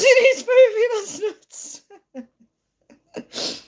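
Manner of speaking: high squeal of laughter through the words; real giggles swallowing the words; cracking up. Real, not acted. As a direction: read it out while helpless with laughter. Cracking up reading a comment.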